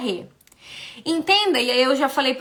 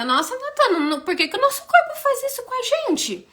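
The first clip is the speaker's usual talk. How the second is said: much higher, incredulous question; puzzled viewer; 11 semitones higher than usual. Retold or imitated. Viewer asking Natana why the body does this, high and baffled. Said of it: "Aí você pensa".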